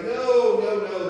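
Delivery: loud, high protesting no; emphatic denial. Acted. Eli denies having called.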